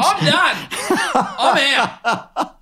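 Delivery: high, loud exclamation with laughter; laughing mock defeat. Reporter retelling his dismissal, laughing mock despair.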